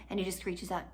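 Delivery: ordinary delivery, nothing marked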